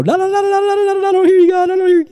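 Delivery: high, singsong mock refusal to listen; fingers-in-ears babble. Imitating someone blocking out God, high childish babbling. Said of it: "stick my finger in my ear and go".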